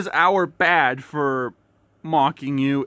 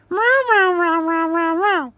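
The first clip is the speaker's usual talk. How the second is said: high burst of laughter; laughing; 8 semitones higher than usual. Real, not acted. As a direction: cackle at the joke. Laughing after joke.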